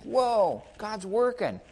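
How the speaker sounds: lightly voiced awed believer; excited wonder. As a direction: marvel at God at work. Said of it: "It's like".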